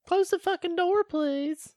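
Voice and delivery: high imitation of a girl telling him off; high mock voice. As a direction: squeak it like an annoyed girl. Quoting Juliette Lewis on toilet.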